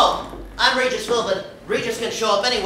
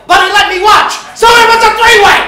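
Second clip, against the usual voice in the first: building to a yelled punchline; 10 semitones higher, 9 dB louder, faster than usual. Acted. Regis telling his honeymoon story, rising excited yell. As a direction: climb much higher and louder, fast at the end.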